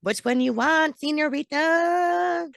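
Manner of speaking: a light mock Spanish accent, señorita drawn out. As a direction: playfully fake a Spanish accent, slow on señorita. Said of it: "all these accents are coming in my head".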